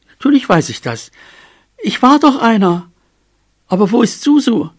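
high, eager mouse voice; protest, then ask anxiously for her; earnest, high little voice; insistent young mouse. A narrator's reading. Mouse insists, asks after Susu.

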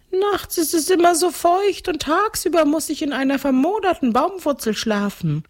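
very high, moaning ghost; wailing complaint. A narrator's reading. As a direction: whine it like an aggrieved spook. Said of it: "jammerte Tatzenmutz".

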